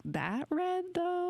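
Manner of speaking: high, doubtful 'that red, though?'; skeptical quote. Retold or imitated. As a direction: act out your own disbelief, pitched well up. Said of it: "but I'm like".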